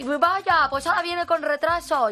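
high, loud airport complaint; mock shouting. Imitating a Spaniard griping about a delayed flight, mock shouting. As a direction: holler it across the terminal in mock outrage. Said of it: "empiezas a gritar ahí en medio".

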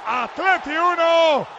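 slow, ringing, emphatic announcer's delivery; live goal commentary. Real, not acted. Commentator selling the boxing match to listeners, ringing play-by-play. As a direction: punch out short phrases, stretching the endings.

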